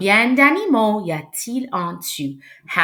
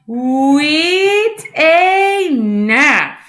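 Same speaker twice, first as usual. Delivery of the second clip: loud, high, very slow count ending; drawn-out finish; 6 semitones higher, 5 dB louder, much slower than usual. Real, not acted. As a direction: stretch each number to a big finish. Triumphant end of counting together.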